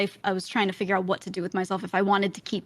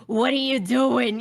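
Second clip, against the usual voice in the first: imitated Tony; 5 semitones higher, 4 dB louder, slower than usual. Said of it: "he's like".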